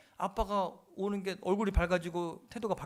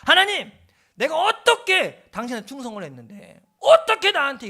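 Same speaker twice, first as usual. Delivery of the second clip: furious retold yell, much higher and louder; bitter church elder screams his complaint at God; 8 semitones higher, 13 dB louder than usual. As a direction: shout up at God like an angry, betrayed man. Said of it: "하나님 앞에 삿대질하면서 고래고래 소리 질렀대요".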